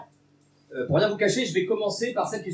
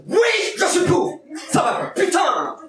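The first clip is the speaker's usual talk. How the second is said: much higher, louder fake indignation; mock rant; 12 semitones higher, 5 dB louder, slower than usual. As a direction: rant as if carried away on stage. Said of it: "je suis en dépassement scénique".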